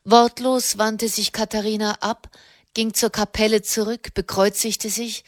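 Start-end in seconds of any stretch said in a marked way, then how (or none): none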